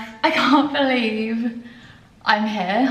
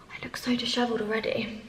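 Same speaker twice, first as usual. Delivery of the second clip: quick, soft self-mocking whisper; a hushed, sheepish aside; 9 dB quieter, faster than usual. Real, not acted. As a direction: confide it under your breath.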